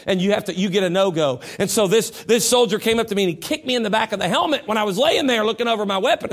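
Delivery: ordinary talk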